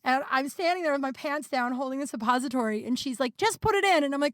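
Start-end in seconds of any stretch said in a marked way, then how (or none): 3.4-4.0 high-pitched, insistent grandma; mild mock yell, pitched well up; imitated grandma yelling. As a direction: imitate grandma urging from outside the door. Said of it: "yelling at me to shove this little pill".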